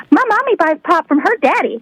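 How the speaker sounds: put-on a child blurting out a family secret. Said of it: "he's like".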